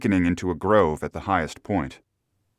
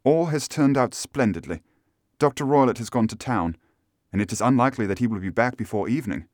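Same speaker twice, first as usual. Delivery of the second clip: higher voice for a pleased client; hopeful lady, pitched up; eager Miss Stoner; 5 semitones higher than usual. Acted. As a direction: play the woman reporting good news.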